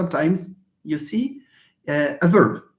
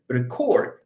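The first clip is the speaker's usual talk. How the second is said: "record" with a weak start and strong "cord"; stress on second syllable; 4 semitones lower than usual. Shown as a demonstration. Teacher shows where verb stress falls, "record" stressed on the last syllable. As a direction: land on the end of "record", re-CORD. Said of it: "it falls here".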